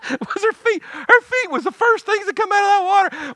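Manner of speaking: very high, animated voice; incredulous comic punchline. Real, not acted. Preacher landing his boat-prank punchline, high and excited. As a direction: squeal the punchline in amused excitement.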